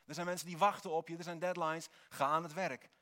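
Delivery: loud, high, urgent self-commands; inner voice screaming. Acted. Preacher voicing his own urge to start working, loud urgent shouting. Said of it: "Dat alles in je schreeuwt".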